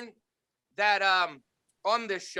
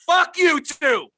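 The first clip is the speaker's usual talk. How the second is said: higher, louder joking yell; mock shout; 5 semitones higher, 6 dB louder, much slower than usual. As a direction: bark it at them for laughs. Mock yelling at friends.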